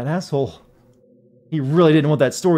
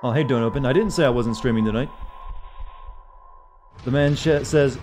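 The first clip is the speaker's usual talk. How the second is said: usual speaking voice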